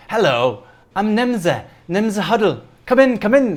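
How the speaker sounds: high, bright-pitched welcoming halfling lady; high chirpy halfling welcome; cheerful halfling host. Acted. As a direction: greet them like an eager little hostess.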